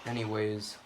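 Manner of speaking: ordinary delivery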